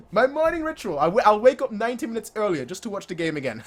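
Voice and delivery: fast, laughing as he says it. Real, not acted. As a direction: giggle through the boast. Laughing through the line.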